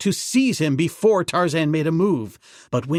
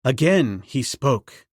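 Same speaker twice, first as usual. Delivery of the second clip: ordinary delivery, nothing marked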